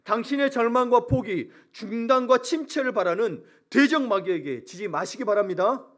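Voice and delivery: preacher at full force, deliberate and loud; forceful preaching. Real, not acted. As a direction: drive each phrase home to the congregation.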